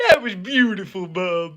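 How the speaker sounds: lightly mimicked catchphrase; imitated co-host praise. Imitating the co-host praising his buddy, lightly put-on voice. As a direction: imitate your buddy's compliment. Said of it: "then you say this".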